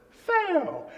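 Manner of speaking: put-on very high, loud little-kid squeak; three-year-old boy. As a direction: pipe up as a tiny child. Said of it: "myself, who was three".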